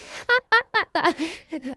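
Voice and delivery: high-pitched mock giggling; acted laughter. Friend Pal laughing at the insult, high acted giggle. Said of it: "My friend Pal just laughed".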